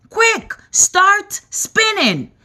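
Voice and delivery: high, slow, urgent command; yelled instruction, pitched high. A narrator's reading. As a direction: shout the order, drawn out. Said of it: "yelled James".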